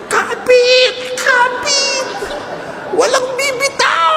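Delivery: high mock shout, all together; threads shouting. Dress threads shouting to hold on, in a high comic yell. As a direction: yell like straining threads holding on. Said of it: "silang nagsisigawan".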